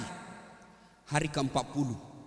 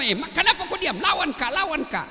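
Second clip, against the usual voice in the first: a hint of a goading wife, higher and laughing; mildly voiced wife; 7 semitones higher than usual. Wife goading her silent husband to argue, laughing scold. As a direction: egg him on to fight back, half-laughing.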